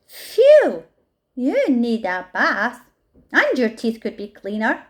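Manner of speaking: wrinkle the nose at the smelly hyena; disgusted creature. A narrator's reading. Fluffalump scolds the smelly hyena with mock disgust. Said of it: "turning to cheeky hyena".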